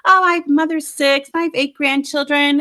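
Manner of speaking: high-pitched, sing-out brag; mock your own cockiness, pitched high; boastful know-it-all, voice lifted; cocky boast. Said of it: "I'm, like, cocky as all get".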